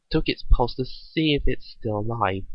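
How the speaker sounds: ordinary delivery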